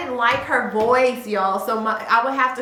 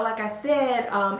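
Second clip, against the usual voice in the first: usual speaking voice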